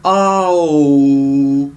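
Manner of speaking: "A" open then "O" closed; "A" ouvert puis "O" fermé; open versus closed o. Shown as a demonstration. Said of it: "accent circonflexe qu'on ferme".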